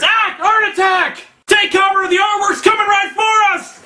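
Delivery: very high hammed-up shouting; panicked mock orders. Shaft orders the team to take cover, mock-panicked shout. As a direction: shriek it like a mock emergency. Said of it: "So Shaft orders".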